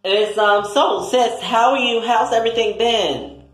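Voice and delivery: high-pitched, eager greeting voice; chatty greeting to sis. Acted. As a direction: greet your sister way up high. High greeting to the sister character.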